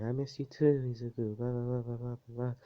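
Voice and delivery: high-pitched imitation of a girl; mock gushing girl. Froppy answering Izuku's greeting, mock gushing. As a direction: lift the pitch and run into blahs.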